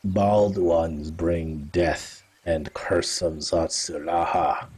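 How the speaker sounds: a grave cat-folk elder warning strangers; Kerber speaking. Acted. Kerber warns of bald ones.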